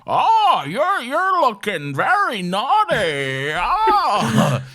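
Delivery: high, loud, drawn-out sex-scene moaning voice; Justin Long impression.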